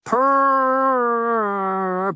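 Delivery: very high, long drawn-out cat cry; cat's trill. Acted. Cat pesters owner just home, long high cry. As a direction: hold a loud, high feline purr-yowl.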